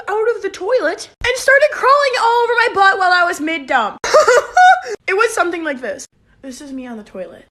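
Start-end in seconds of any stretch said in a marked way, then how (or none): 4.0-5.0 loud laugh. Real, not acted. Laughing about the bee.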